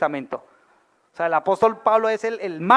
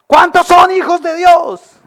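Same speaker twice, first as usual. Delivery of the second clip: calling out; 9 semitones higher, 10 dB louder, slower than usual. Real, not acted. Preacher calls out to congregation.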